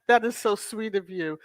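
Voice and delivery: normal talking voice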